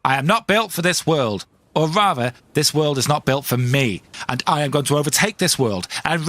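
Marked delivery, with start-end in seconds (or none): none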